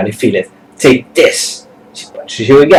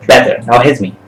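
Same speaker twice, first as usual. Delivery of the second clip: ordinary delivery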